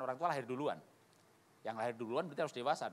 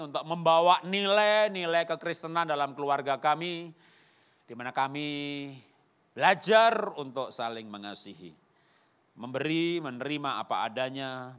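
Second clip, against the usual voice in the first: congregational prayer; 7 dB louder than usual. Real, not acted. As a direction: lead the congregation in earnest, swelling prayer. Leads the closing prayer. (doa jemaat yang khusyuk dan lantang)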